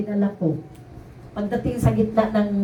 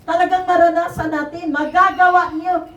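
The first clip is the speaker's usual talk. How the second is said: preaching; 5 semitones higher, 5 dB louder than usual. Acted. Testifying to the congregation, preaching at full force.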